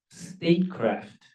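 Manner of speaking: statecraft, drawn out slow and set apart; emphasized word. Real, not acted. Former diplomat stressing his key word, statecraft, slow and weighty. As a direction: lean on statecraft, slowly, each sound weighed. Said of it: "I emphasize that word statecraft".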